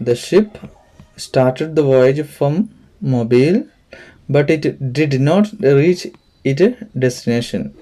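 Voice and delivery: very slow, spaced-out sentence reading; textbook read-aloud. Real, not acted. Teacher reading an example to pupils, slow and deliberate. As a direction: read slowly, pausing between phrases.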